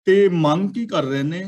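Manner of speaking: ordinary delivery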